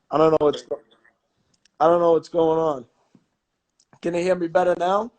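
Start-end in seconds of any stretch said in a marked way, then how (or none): 0.1-2.9 breaking up over the line; bad connection. Real, not acted. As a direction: voice cutting out on a bad call. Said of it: "You're cutting out again".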